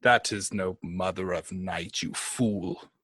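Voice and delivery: low, imperious rebuke; scornful dark lord. Acted. Posing as a tyrant god to a frightened servant, scornful. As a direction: talk down to a foolish minion.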